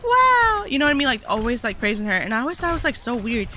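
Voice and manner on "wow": high, squealy fake-sweet cheering; mocking gushing praise. Mocking the guys who gushed over her kill, high and squealy. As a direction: gush over her like a fan. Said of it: "and they'd be like".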